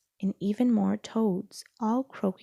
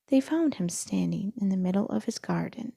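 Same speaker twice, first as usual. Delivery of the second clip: ordinary delivery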